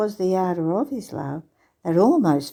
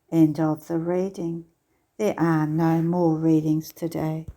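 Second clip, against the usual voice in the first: ordinary talk, nothing marked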